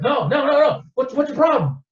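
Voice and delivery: high, loud, picking a fight; aggressive bar thug. Retold or imitated. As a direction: get in his face, shrill with menace.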